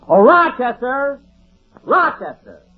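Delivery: loud, drawn-out call across the house. Acted. Jack calls loudly for Rochester from the pantry. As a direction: summon the butler from afar. Calling out for the butler.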